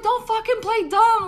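high, loud angry snap; going off on him. Retold or imitated. Telling her date not to play dumb, high angry snap. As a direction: cut him off sharply. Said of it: "I went off on him".

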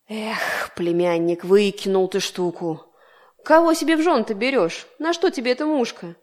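put-on an old horsefly scolding his nephew; grumbling uncle. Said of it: "сел на ветку и заворчал". (ворчливо, с упрёком)

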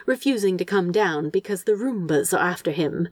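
ordinary delivery, nothing marked

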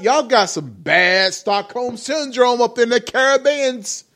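usual speaking voice

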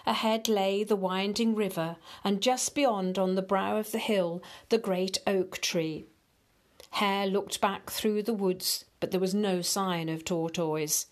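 ordinary delivery

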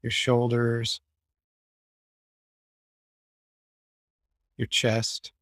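low, even meditation voice with long pauses; guided meditation. Acted. Guiding the group to relax and release tension, calm meditation voice. Leads a guided body scan.

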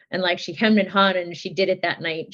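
ordinary talk, nothing marked

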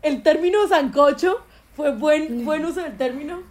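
high, slow, laughing admiration; amused praise. Real, not acted. Host laughs and praises Sofía's wise remark, high and amused. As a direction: gush at her through laughter.

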